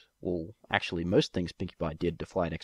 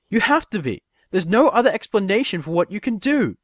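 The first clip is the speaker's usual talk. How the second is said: high, loud insistent yell; 13 semitones higher, 9 dB louder than usual. A narrator's reading. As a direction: demand an answer from the book. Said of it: "she yelled".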